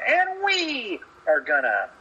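mock Seth Rollins promo. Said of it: "such a pansy promo".

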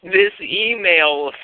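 ordinary talk, nothing marked

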